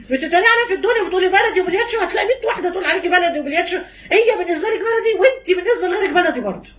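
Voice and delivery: fast, high heated scolding with laughter; excited rebuke. Real, not acted. Preacher scolding a mocking sister, fast and high with laughs. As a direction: scold her playfully but hard.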